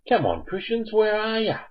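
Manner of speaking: high sing-song call to the callers. Real, not acted. Host calling out for callers.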